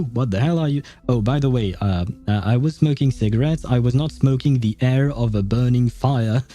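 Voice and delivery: normal talking voice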